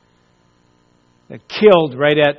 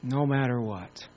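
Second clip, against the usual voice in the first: soft echo; 5 semitones lower, 12 dB quieter than usual. Real, not acted. Preacher echoes promise, softly.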